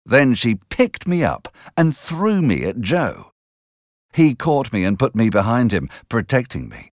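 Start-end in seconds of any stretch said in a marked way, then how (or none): none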